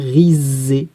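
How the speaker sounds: riser said slowly with a long buzzing z; slow, syllable-by-syllable riser, ri-zzzé; drawn-out Z sound. Shown as a demonstration. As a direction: stretch the z in riser. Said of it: "je veux faire Z".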